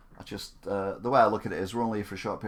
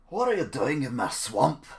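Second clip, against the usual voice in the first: loud, slow Scottish ogre impression; Shrek; 3 semitones higher, 6 dB louder than usual. Streamer trying the swamp line, loud Shrek impression. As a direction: bellow it slowly, leaning hard on each word. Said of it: "Do Shrek".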